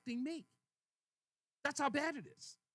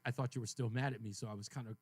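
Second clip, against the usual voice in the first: low, downcast retold explanation; subdued, dark mood; 9 semitones lower than usual. Husband explains tiptoeing around wife, subdued. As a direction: explain yourself in a lowered voice. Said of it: "kind of in a dark place".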